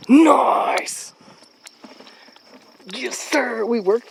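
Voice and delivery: loud, triumphant whoop; excited cheer. Real, not acted. Celebrating a successful kill.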